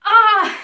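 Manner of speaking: sharp loud cry of pain; pained gasp. Acted. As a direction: gasp awake in agony. Waking in pain, scream.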